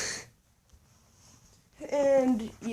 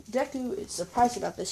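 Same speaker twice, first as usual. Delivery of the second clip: ordinary delivery, nothing marked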